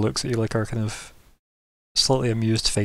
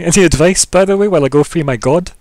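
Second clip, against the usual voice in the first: louder, raised in-character rant; mock exasperation; 3 semitones higher, 8 dB louder than usual. Drained hero asks the queen for advice, mock exasperated. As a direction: play up a loud, exasperated plea. Player acts out character's blunt plea.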